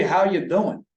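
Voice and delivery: a playful New Jersey "how you doin'" greeting; light, jokey Jersey accent greeting. Shown as a demonstration. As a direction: throw it out like a guy from New Jersey. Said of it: "Not like I do in a Jersey accent".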